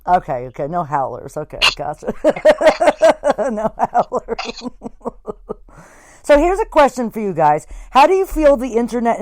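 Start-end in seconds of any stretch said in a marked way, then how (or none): 2.2-4.7 drawn out slowly through real laughter; laughing. Real, not acted. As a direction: repeat it while cracking up. Repeats it while laughing.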